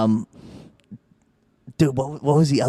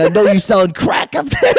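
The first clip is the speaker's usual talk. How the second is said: higher-pitched imitation of a man losing it; mock frantic yell; 9 semitones higher, 4 dB louder than usual. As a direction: mimic an unhinged heckler, pitched up. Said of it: "he's losing his mind".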